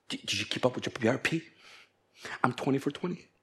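hushed, quick self-satisfied exchange; smug churchgoer. Acted. Preacher acting members comparing Bible reading, hushed smugness.

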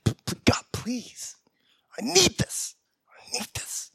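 stammering, breathy whispered plea; desperate whisper prayer. Pretend. Job seeker begging God, whispered plea. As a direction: beg God in a broken whisper.

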